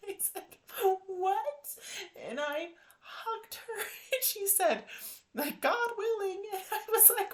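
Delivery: high, tearful laugh while telling; half laughing, half crying with joy; laughing through tears. Real, not acted. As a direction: overcome with joyful tears. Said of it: "laughing and crying while retelling".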